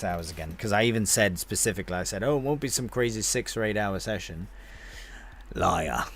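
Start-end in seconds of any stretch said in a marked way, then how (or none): none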